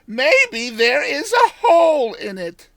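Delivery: put-on high, loud old village woman; old countrywoman.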